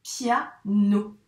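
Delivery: piano split as pia - no; syllable by syllable. Shown as a demonstration. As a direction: separate piano into pia and a held no. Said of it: "syllabe où on entend le son N". (piano découpé : pia - nno)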